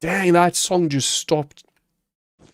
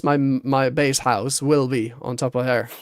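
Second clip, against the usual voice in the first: normal talking voice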